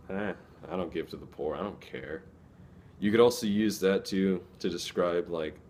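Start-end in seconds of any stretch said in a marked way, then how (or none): 0.1-2.2 softer, shrugging indifference; dismissive. Shown as a demonstration. Someone shrugging off giving to the poor, dismissive. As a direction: brush it off like you couldn't care less.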